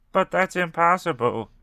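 mild dramatic protest; emphatic declaration. A narrator's reading.

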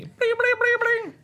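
very high comic bleeping; imitated scanner noise. Host joking about a brain scan, high bleeping noise. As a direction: bleep rapidly like a silly machine. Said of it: "And they all make those noises".

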